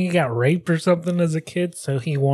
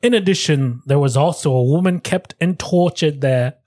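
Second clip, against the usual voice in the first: hammed-up Australian reading of a quote; dramatic quote in amateur Australian; Australian accent; 2 semitones higher than usual. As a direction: keep the Australian accent going. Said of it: "it got more Australian".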